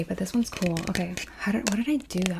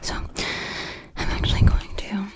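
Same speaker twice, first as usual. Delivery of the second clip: ASMR whisper. Real, not acted. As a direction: whisper close to the mic.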